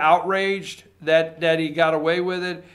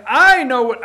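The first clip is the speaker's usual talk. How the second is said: higher and louder, a scoffing know-it-all; mock listener retort; 6 semitones higher, 9 dB louder than usual. Host mimicking a listener who thinks they know, scoffing. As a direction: sneer back like a smug listener. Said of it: "I know you're out there saying".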